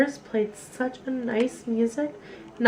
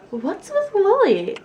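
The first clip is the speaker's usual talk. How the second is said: puzzled mother; 10 semitones higher, 3 dB louder than usual. Acted. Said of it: "asked her mother".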